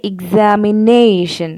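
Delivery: "examination" said slowly, leaning on the "na"; primary stress on "na". Shown as a demonstration. Teacher giving another stress example, slow stressed "examination". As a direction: stretch "examination" at its stressed fourth syllable. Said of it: "ന എന്നുള്ള ആ ഭാഗത്തിനാണ് primary stress".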